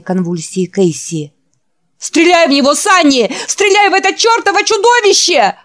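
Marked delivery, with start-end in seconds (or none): 2.0-5.5 very high, loud frantic yell. A narrator's reading. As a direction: scream it in terror. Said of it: "орал Орвик". (истошный крик)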